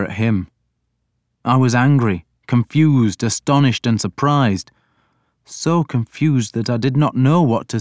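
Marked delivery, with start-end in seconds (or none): none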